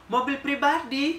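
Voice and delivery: echoing "Mobil pribadi?" slowly to mock her. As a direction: stretch it out in scornful disbelief. Narrator laughing at the claim.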